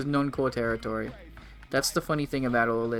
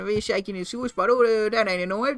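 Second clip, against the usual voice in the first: hammed-up Australian accent, higher and twangy; mock Australian accent; 6 semitones higher than usual. Streamer pretending to be the Australian mailman, in a put-on Australian accent. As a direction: play a cartoon Aussie bloke, Australian accent all through. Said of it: "I am Australian".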